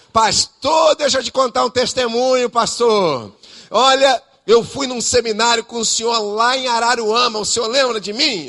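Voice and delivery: excited fan. Retold or imitated. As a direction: gush at him like a fan.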